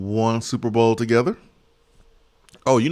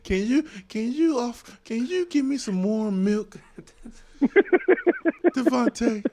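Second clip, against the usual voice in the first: mock whiny plea; 12 semitones higher than usual. Mock pleading to Devontae.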